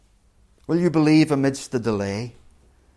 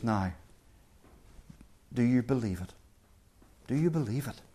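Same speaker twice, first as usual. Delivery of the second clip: soft, gentle asking, much quieter; 3 semitones lower, 8 dB quieter than usual. Retold or imitated. Jesus gently asking his people, in a soft tender voice. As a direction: ask it tenderly, almost hushed. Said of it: "he says to us gently".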